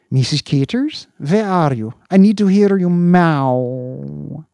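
high, loud, slow cooing; eager cat owner. Acted. Owner calling for his cat, eager cooing. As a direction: coo longingly for his cat.

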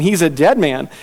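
ordinary talk, nothing marked

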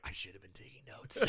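hushed rueful aside. Retold or imitated. Co-host realising his mistake, hushed laughing aside. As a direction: whisper it to yourself, about to laugh. Regretful aside to himself, breaking up.

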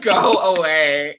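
slow, drawn-out laughing words. Real, not acted. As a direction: laugh through a teasing brush-off. Teasing after donation joke.

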